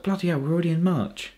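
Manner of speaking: ordinary talk, nothing marked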